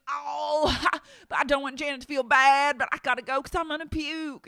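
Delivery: high, pitiful mock wail; Brittany. Wife about to puke excuses herself, mock wailing. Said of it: "She goes".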